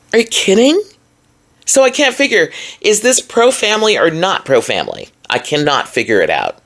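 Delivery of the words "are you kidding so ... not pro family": incredulous. Real, not acted. Outraged disbelief at the policy.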